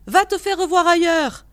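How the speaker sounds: loud, high irate retort; angry dismissal. A narrator's reading. Voice from the window sends the visitor away, angrily. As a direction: throw the words down at the intruder. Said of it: "répondit la voix".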